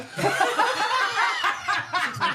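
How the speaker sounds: big burst of laughter; loud laugh. Real, not acted. Group cracks up at a joke.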